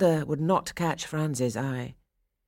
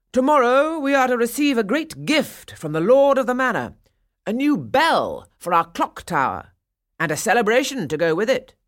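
loud, raised public proclamation; pompous announcement; 8 semitones higher, 6 dB louder than usual. A narrator's reading. Burgomaster announcing a gift to townsfolk, loudly declaiming. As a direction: proclaim it to the whole square. Burgomaster addresses the town crowd.

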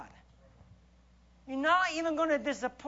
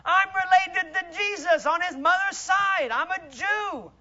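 proud, brushing-off retort, raised voice; retold street man; 6 semitones higher, 7 dB louder than usual. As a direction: brush the preacher off loudly. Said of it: "and he said".

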